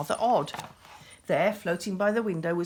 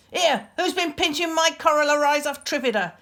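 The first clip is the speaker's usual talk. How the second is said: loud, raised accusing roar; roaring accusingly; 7 semitones higher, 7 dB louder than usual. A narrator's reading. The beast accuses Meredith, loud and raised. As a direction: bellow the accusation at him. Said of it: "it roared, looking accusingly".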